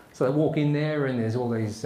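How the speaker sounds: usual speaking voice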